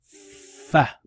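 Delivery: "Fa" said loud and high, clearly drawn out; demo Arabic fa. Shown as a demonstration. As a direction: model the sound "Fa" for students to repeat. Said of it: "Pronounce it with me".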